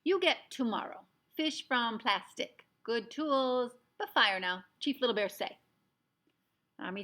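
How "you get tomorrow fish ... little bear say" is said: loud, higher, clipped brave's demands; a proud chief listing his orders; commanding little warrior voice; Little Bear voice. A narrator's reading. Little Bear insists on fire.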